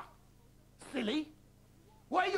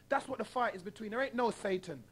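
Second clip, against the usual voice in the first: ordinary talk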